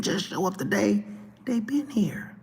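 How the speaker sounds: a hushed pointed aside; whisper. Real, not acted. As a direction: drop to a whisper as if sharing a secret. Knowing aside to viewers.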